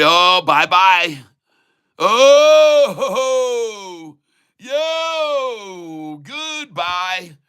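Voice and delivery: high, loud singsong goodbye taunt; mock farewell. Streamer taunting short sellers with a cry. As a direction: crow goodbye at the losing shorts. Mocking shorts as price breaks.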